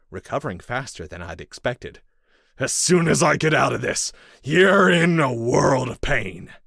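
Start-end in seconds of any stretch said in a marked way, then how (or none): 2.6-6.5 loud threat. A narrator's reading. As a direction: promise revenge loudly. Captive man threatening.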